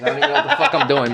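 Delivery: high, fast laughing. Real, not acted. Telling friend about spinning tires, laughing. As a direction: crack up telling it.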